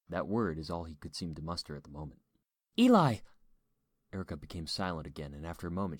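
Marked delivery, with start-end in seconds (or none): 2.8-3.2 high, loud call of his name; urgent cry. A narrator's reading. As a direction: cry out to your husband in alarm. Frightened wife cries husband's name.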